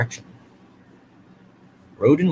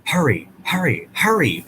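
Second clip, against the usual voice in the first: urgent, a little louder, lightly panted; mild mock panting; 5 semitones higher, 5 dB louder than usual. A narrator's reading. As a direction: push the words out like a straining engine. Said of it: "panted Thomas".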